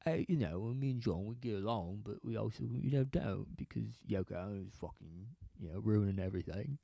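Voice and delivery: low, soft, badly faked British accent. Musician explaining band trouble, badly faked soft British accent. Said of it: "I don't even think I'm doing [name]".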